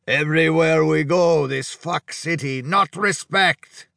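put-on slow, high-pitched complaint in thick accent; aggrieved Hungarian. A narrator's reading. Drunk Hungarian complaining to the cook, aggrieved and heavily accented. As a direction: drag out each word as an aggrieved, accented drunk. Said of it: "the Hungarian bellowed".

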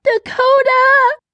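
high, drawn-out cry of panic; panicked mother's high scream. A narrator's reading. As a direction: scream the name, held long on a high pitch. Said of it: "and she screamed".

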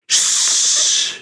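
unvoiced shush. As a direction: hush them sharply. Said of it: "they all said".

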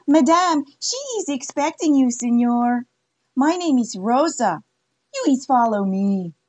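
put-on high, bright-eyed Mexican-accented welcome. Rosa welcoming Jude at the brothel, Mexican accent. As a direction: Rosa greeting the gunslinger in broken English.

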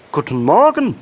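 high, loud, happy frog greeting; cheerful call. A narrator's reading. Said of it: "rief er fröhlich". (fröhlicher, hoher Froschruf)

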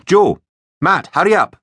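high, loud teacher's call. A narrator's reading. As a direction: hurry pupils along from across the room. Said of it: "called Miss Bruce".

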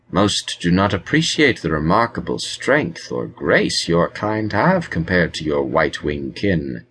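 usual speaking voice

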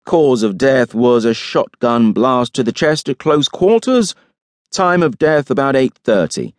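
deliberate forensic report in character; pathologist giving findings. A narrator's reading.

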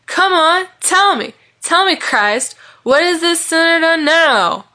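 high, loud mocking plea; jeering taunt. A narrator's reading. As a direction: demand an answer, drawing out the last line. Drunk man jeers at his friend.

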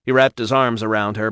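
usual speaking voice

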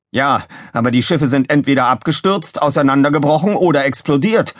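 a touch of huffy scorn; Gizmo, snorting. A narrator's reading. Engineer belittles rival's flying record, mild snort. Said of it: "schnaubte Gizmo".

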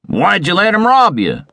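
high-pitched outraged outburst; thundering. A narrator's reading. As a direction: thunder it in disbelief and anger. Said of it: "thundered Visconti".

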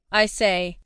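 ordinary delivery

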